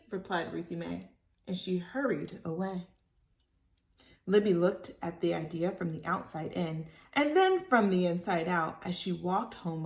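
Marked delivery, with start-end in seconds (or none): none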